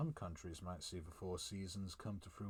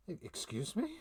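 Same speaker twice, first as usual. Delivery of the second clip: startled question; 8 semitones higher, 4 dB louder than usual. Acted. Character caught off guard.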